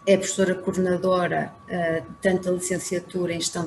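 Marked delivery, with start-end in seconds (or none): none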